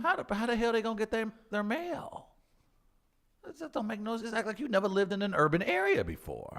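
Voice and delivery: high, fast indignant rant; high-pitched rant. Acted. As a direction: squeal it out in outrage.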